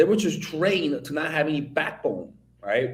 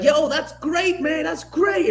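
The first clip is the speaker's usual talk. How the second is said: higher, upbeat mock praise from a cheerful stepdad; imitating proud stepdad; 13 semitones higher, 3 dB louder, slower than usual. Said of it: "my stepdad was like".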